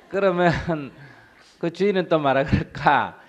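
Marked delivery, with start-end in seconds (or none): none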